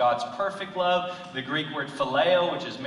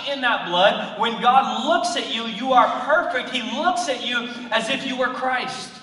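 loud, high preaching voice; fired-up pulpit delivery; full-force preaching; 9 semitones higher, 9 dB louder than usual. Acted. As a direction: preach it out to the whole room.

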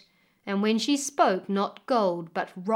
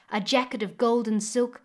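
plain reading voice

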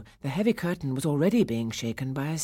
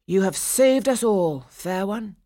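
raised, projected storybook hero voice; gallant prince; 4 semitones higher, 7 dB louder than usual. A narrator's reading. Prince thanks Clara after the battle, gallantly proclaiming. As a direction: proclaim thanks loudly and a little higher.

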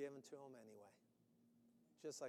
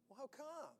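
surprised Moses; 9 semitones higher, 4 dB quieter than usual. Acted. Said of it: "He says".

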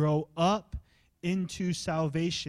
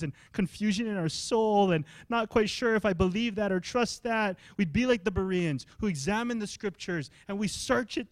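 raised, urgent preacher's voice; impassioned preaching; 6 semitones higher, 5 dB louder than usual. Real, not acted. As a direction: preach a fervent prayer, building to loud emphasis.

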